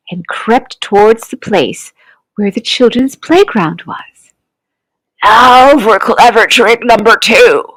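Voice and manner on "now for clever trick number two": sly crocodile voice, muttered to himself; plotting villain talking to himself; scheming crocodile. A narrator's reading. Crocodile to himself, planning a trick: sly villain voice. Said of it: "he said to himself".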